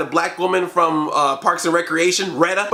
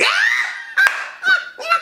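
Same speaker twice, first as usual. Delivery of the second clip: very high hysterical laugh; shrieking laugh. Real, not acted. Reviewer shrieking with laughter at the movie. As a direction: let out a squealing laugh.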